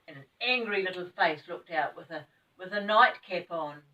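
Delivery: usual speaking voice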